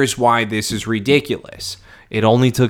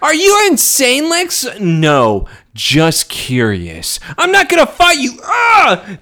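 high, pitched-up Lionel protesting; high mock Lionel; 12 semitones higher than usual. Lionel refusing to fight his son, high protest. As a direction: squeal it high as a scared father.

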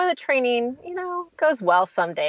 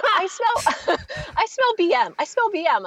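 put-on higher sing-song child announcing; 10 semitones higher than usual. Daughter announcing the smell in a high child voice. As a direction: chant it like a little kid. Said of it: "she's like".

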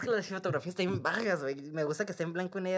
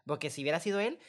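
normal talking voice

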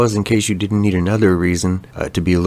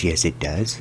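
ordinary delivery

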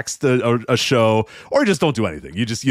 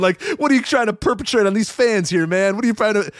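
higher, lightly voiced riled-up host; hammed-up radio host pressing; mock pissed Rome; 9 semitones higher than usual. Rome grilling the NBA commissioner, mock riled-up host voice. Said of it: "he's pissed".

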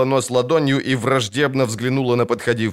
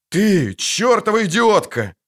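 high, drawn-out furious yell; angry shout; 10 semitones higher, slower than usual. A narrator's reading. Witcher yells at the dryad who shot, angry shout. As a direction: lean slowly on the insult, outraged. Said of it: "крикнул ведьмак".

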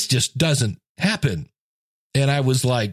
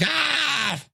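mock groan; 11 semitones higher, 4 dB quieter than usual. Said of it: "He was like".

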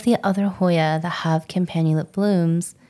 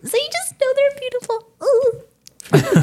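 gushing; slower than usual. Real, not acted. Gushing over swelling buds.

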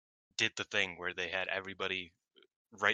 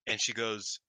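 ordinary talk

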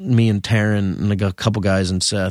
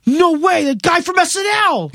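mock excited fan. Fan on the street spots him from SNL, high excited squeal. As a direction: squeal it like a fan spotting a star.

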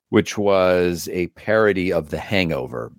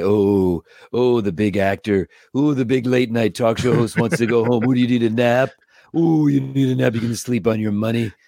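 mocking puppet voice, jabbing at the host; cheeky put-on robot voice ribbing his boss; taunting robot Jeff. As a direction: needle the big star as the robot sidekick. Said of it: "make Jeff's head turn and look at Craig and say".

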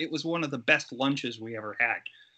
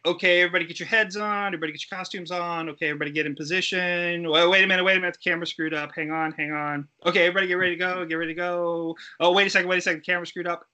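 rapid-fire higher assistant-director patter; fast, pitched-up crew instructions interrupted by a camera glitch; hurried set call; 4 semitones higher, much faster than usual. Retold or imitated. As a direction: rattle off the set calls fast, then stop short. Harried crew calling set instructions.

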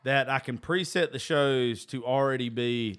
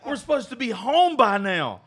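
angry outburst; 9 semitones higher, 3 dB louder than usual. Retold or imitated. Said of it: "I'm like".

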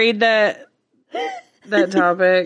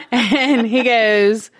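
usual speaking voice

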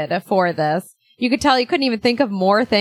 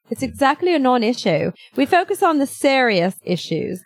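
snooty embassy spokeswoman reading a statement; snotty; 3 semitones higher than usual. Acted. Embassy representative brushing off a press question, snotty. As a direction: put on a haughty, dismissive official voice. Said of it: "This is my snotty".

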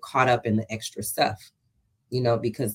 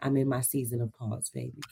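lightly voiced auntie, softer and lower; 3 semitones lower, 8 dB quieter than usual. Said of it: "why you say it like the auntie".

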